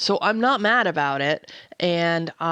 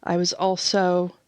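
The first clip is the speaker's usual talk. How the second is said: normal talking voice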